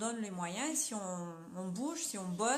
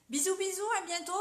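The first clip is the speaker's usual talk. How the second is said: cheery goodbye; 8 semitones higher, 4 dB louder, faster than usual. Real, not acted. Sign-off to live viewers.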